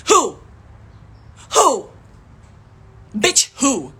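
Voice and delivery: loud, punched-out challenge; indignant demand. Real, not acted. Challenging commenters who generalize, loudly indignant. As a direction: fire each "who" like an accusation.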